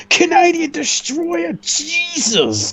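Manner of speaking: shrieking the move name high; excited. Real, not acted. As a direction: yelp it out in disbelief. Commentator reacts to big move.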